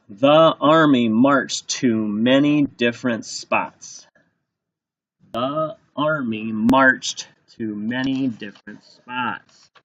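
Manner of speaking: slow, word-by-word sentence reading; slow dictation. Shown as a demonstration. Teacher dictating first sentence to write, slowly. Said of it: "here's your first sentence".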